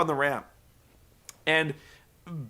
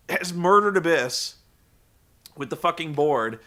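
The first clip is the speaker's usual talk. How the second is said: ordinary talk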